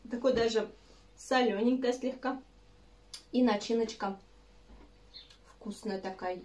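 speaking between bites; chewing while talking. Real, not acted. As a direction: mouth partly full of cookie. Seen 1: Holds dark cookie at mouth, bites and chews while talking throughout.

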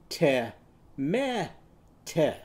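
"Te, me, te" as teh, meh, teh; tomato with eh. Shown as a demonstration. Teacher changes tomato's vowels, eh in each syllable. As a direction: separate syllables of "Te, me, te", each with short eh. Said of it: "if we use eh in each syllable".

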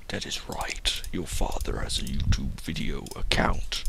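breathy, hushed dramatic secret; whispered reveal. Pretend. Father revealing his YouTube account, whispered.

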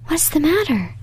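Beauty seeing the prince stretched out weary, alarmed cry. Acted. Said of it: "she cried".

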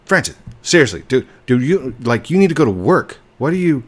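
loud, high calling out Francis; exasperated scolding. Real, not acted. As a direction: scold the pest to back off. Scolding clingy Enderman.

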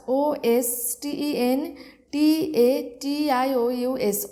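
slow, each letter spoken apart; spelled letter by letter. Shown as a demonstration. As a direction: spell it out slowly for dictation. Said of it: "syllable ভেঙে ভেঙে বলে দিচ্ছি". (ধীরে ধীরে অক্ষর ভেঙে বানান বলা)

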